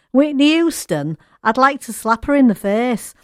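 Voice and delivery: quoting the singer with a hint of an American accent; American accent impression. Radio host quoting a singer's remark in a light American accent. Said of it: "I am doing the accent there".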